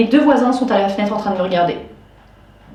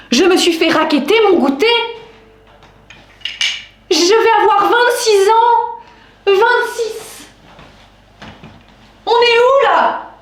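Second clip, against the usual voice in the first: indignant disbelief; 8 semitones higher than usual. Real, not acted. Woman tells viewers she was robbed of her snack at twenty-six, indignant. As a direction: protest in a raised, incredulous voice.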